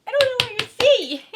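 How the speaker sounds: loud, high-pitched burst into laughter; laughing. Real, not acted. Joking in an outtake, laughing high and loud. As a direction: squeal it out, breaking into a laugh.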